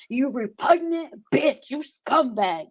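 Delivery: a light mock of a prim insult; imitating her cussing. Mocking how a rival cusses at people, lightly imitated. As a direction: sneer it like she would. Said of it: "That's how she talks".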